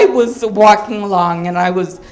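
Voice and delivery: ordinary talk, nothing marked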